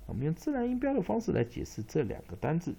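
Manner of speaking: ordinary delivery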